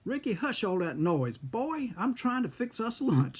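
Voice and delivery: high-pitched imitation of a scolding grandmother; grandmother yelling. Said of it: "My grandmother yelled out there".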